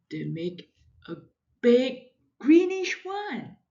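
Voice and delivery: ordinary delivery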